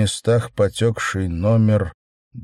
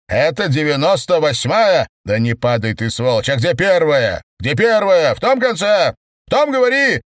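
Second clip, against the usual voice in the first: shouting demands; 7 semitones higher, 5 dB louder than usual. Acted. Kedrin demands where the first is.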